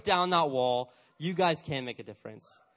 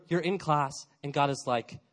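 ordinary talk

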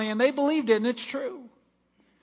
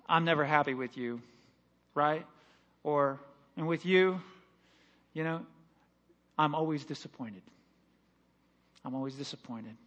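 disapproving voice; 6 semitones lower, 6 dB quieter than usual. Retold or imitated. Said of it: "this other voice".

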